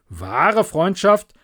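wahre Freundschaft drawn out, slow and loud; slow emphasis. Acted. The miller lecturing Hans, stressing wahre Freundschaft slowly. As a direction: lean hard on wahre Freundschaft. Miller leans on his point.

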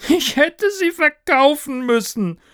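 very high, loud, ghostly wail; howling. Acted. Ghost learns shares are worthless, despairing howl. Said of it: "heulte er".

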